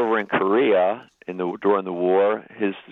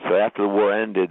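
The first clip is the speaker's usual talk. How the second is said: normal talking voice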